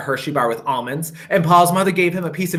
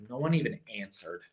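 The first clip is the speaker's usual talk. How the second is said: usual speaking voice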